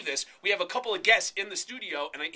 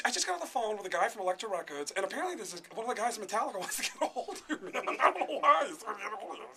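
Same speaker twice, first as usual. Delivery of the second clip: mocking imitation of a baffled radio producer; Gary Dell'Abate; 4 semitones higher, faster than usual. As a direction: get higher and faster, rattling it off in puzzlement. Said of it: "Gary Dell'Abate".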